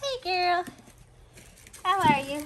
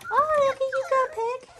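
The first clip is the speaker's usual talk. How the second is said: high, loud delighted call; delighted cheer; 12 semitones higher, 6 dB louder than usual. Real, not acted. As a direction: cheer the puppy on. Cheering puppy.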